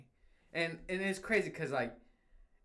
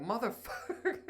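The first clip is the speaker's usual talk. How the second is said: laughing curse; 8 semitones higher than usual. Retold or imitated. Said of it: "And I'm like".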